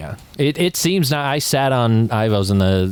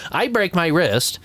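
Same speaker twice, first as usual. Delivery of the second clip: ordinary talk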